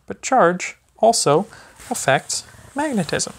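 sing-song picture-book reading to a little one; gentle, lively storybook voice for a toddler. Acted. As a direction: read it like bedtime story time for a baby. Reads a baby book aloud.

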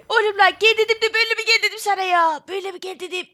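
scolding the buddy who came at the wrong moment; mock scolding. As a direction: tell him off in half-joking outrage. Scolding friend after scare.

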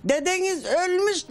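high slow call of Dedeniz ölmüş; retold shout. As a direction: tell the grandson his grandfather is dead, crying out. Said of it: "Bağırdım". (torununa yüksek sesle sesleniş)

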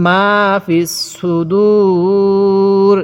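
very slow, with a long held u before the closing r; Quran recitation demo. Shown as a demonstration. Teacher modelling the verse ending, drawn-out recitation. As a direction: stretch the last word right out. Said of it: "আমার সাথে পড়ুন".

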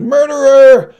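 very high, very loud shriek; baby's all-out shrieked accusation; escalating accusing shout. A narrator's reading. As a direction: scream the accusation at full pitch. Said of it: "the baby shouted".

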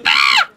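short, high, loud shriek; high scream. Acted. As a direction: shriek at a sudden noise. Sudden fright.

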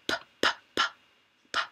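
"P, p, p" as breathy unvoiced puffs; sounding out P. Shown as a demonstration. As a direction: puff each "p" out with no voice.

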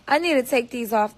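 usual speaking voice

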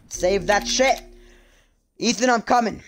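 loud call to Ethan. Real, not acted. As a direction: shout it across the room. Calling to game character.

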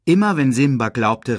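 plain reading voice

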